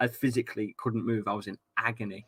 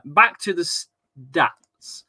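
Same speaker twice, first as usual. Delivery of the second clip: stretched out slowly, a little higher and louder, like a segment jingle; drawn-out announcement; 4 semitones higher, 4 dB louder, much slower than usual. Pretend. Host announcing the return to stats, drawn out. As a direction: drag out back to the stats as an announcer.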